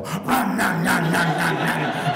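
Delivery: greedy gobbling noises; mimed frantic chomping. Retold or imitated. Sugar addict devours the whole pack, chomping noisily. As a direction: chomp loudly through each nom.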